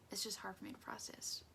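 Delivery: whispered aside of disgust. Real, not acted. Preacher confiding her disgust at feet in a whisper. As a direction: breathe it out as a whisper. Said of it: "I hate feet".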